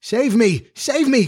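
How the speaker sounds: high-pitched plea for rescue; frightened cry. A narrator's reading. Boy chased by wolves begs his friends, high frightened cry. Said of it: "cried Nibs".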